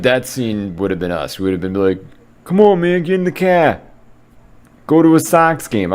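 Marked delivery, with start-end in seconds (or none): 2.4-5.9 Boston accent bellowed in mock earnest; hammed-up Boston accent. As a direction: shout it like a Boston pal, Boston accent pushed up high. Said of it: "I'm just gonna keep doing".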